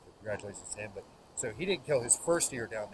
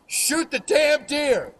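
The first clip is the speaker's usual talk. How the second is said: full-volume, high-pitched drawn-out holler; imitating grandfather's explosive yell; 17 dB louder, much slower than usual. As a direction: yell it at the top of your lungs, stretching each word. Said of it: "he yells just like that".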